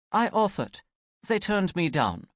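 offhand. Acted. Gretchen shrugs, offhand.

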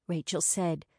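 ordinary delivery

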